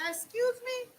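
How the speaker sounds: loud, high, affronted question; indignant disbelief. Acted. Girlfriend reacting to his sister's message, indignant disbelief.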